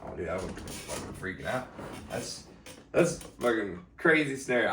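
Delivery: ordinary delivery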